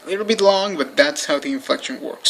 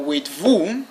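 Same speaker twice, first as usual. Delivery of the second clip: usual speaking voice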